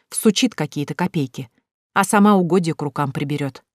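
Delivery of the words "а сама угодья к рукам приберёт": plain reading voice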